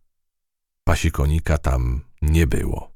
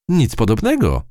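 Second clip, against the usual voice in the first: protesting; 9 semitones higher, 3 dB louder than usual. A narrator's reading. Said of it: "zaprotestowała myszka".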